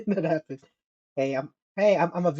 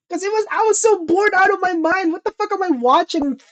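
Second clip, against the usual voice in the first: exasperated; 13 semitones higher than usual. Retold or imitated. Retelling boredom in the movie.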